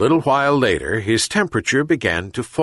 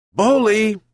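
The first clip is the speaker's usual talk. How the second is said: high, loud call to the dog; shouted call; 12 semitones higher, 3 dB louder than usual. A narrator's reading. Old man calls his dog to come, loud high shout. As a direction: call the dog from across the yard. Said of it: "shouted the old man".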